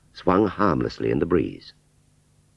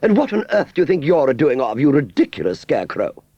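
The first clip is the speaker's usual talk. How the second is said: high, loud snooty scolding; haughty Aunt Sally; 10 semitones higher, 4 dB louder than usual. A narrator's reading. Aunt Sally scolding the scarecrow from her window, high and haughty. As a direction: look down your nose and scold.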